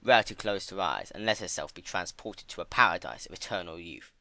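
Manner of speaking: ordinary talk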